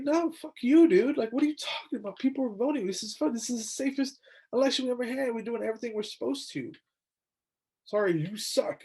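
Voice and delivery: hammed-up telling-off, fading at the end; mock angry retort. Secretary of State snapping back at Trump, imitated anger. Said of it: "the state secretary was like".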